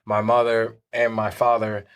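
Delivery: ordinary talk, nothing marked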